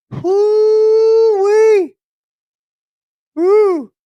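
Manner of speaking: high, loud whooping cheer; excited whoop. Acted. Streamer cheers as Bob appears, loud whoop. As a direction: whoop it out like a hype man.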